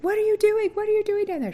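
very high, loud alarmed yelp; panicked shriek. Retold or imitated. At the gynecologist breaking her water, a loud panicked shriek. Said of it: "and I was like".

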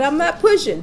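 high, indignant denial; acted protest. Laboring mother answering the doctor, acted high protest.

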